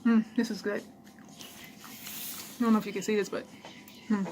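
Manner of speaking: talking while chewing; words around a bite of food; mouth full. Real, not acted. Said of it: "sorry if I talk with food in my mouth".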